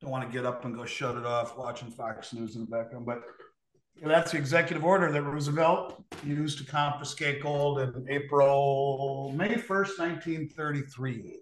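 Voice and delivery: muffled tv noise behind the voice; talking over a tv in another room; tv in the background. Real, not acted. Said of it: "Sorry for the T V in the background".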